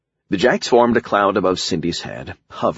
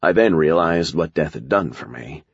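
usual speaking voice

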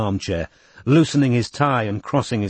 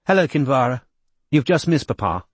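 suddenly cool; 4 semitones higher than usual. A narrator's reading. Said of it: "suddenly cool".

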